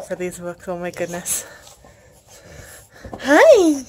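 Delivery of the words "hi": high, loud cooing hi for the puppy; pet-talk hi. Real, not acted. Vlogger turns to greet her cute puppy, high pet-talk voice. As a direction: coo a greeting at the dog.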